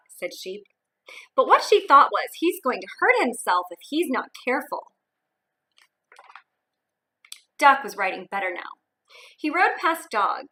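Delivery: put-on lively read-aloud for young kids; expressive picture-book narration; storybook reading.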